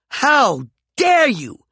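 slow, high, loud outraged shout. Acted. Peregrine shouts at McCoy in outrage. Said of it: "Peregrine shouted".

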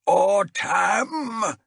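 high, loud bellow across the hall. Acted. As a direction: roar the name at your enemy. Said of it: "he bellowed".

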